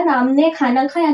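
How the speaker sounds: ordinary talk, nothing marked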